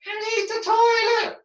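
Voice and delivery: ventriloquist-style "I need the toilet" through nearly closed lips; barely moving lips. Shown as a demonstration. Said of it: "whereas it would be".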